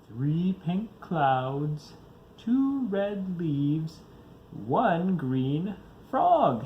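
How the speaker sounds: very slow, drowsy counting. Acted. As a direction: draw each color out sleepily. Bear counts colors sleepily.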